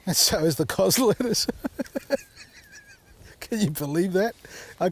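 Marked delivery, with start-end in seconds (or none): 1.5-2.2 soft laugh. Real, not acted. Garden wrecked by sheep.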